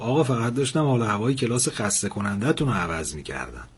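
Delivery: ordinary delivery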